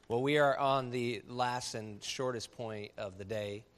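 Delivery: usual speaking voice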